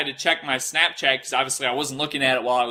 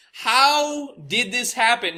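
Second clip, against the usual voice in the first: higher, slower, drawn-out repeat of the question; stronger disbelief; 9 semitones higher, much slower than usual. Retold or imitated. Repeating it to himself at the TV, higher and slower disbelief. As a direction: push it up higher, stretching every word. Watching CNN call him shooter.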